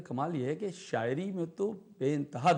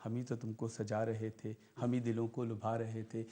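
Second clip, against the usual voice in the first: quieter, tender verse lines; soft poetic recitation; 4 semitones lower, 6 dB quieter than usual. Acted. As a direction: lower the voice for these lines. Recites stanza softly.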